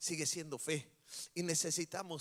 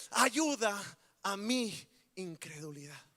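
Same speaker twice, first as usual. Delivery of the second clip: pleading clamor; 4 dB louder, slower than usual. Acted. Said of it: "Hace un clamor".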